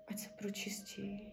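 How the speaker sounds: barely voiced whisper; whispered blessing. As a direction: breathe the blessing out. Whispered incantation. (šepot)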